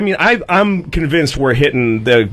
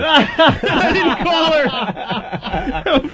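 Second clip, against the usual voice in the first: high, drawn-out laughing confession; 11 semitones higher, much slower than usual. Real, not acted. Telling he didn't call his wife, laughing hard. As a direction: squeeze it out through laughter.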